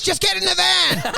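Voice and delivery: high-pitched mock yell; retold yell. Retelling the man at the pub, mock yell. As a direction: yell it as an imitated impatient demand. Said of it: "He came back and yelled".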